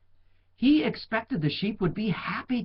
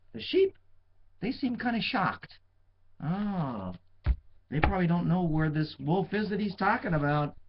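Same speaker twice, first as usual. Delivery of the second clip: ordinary delivery, nothing marked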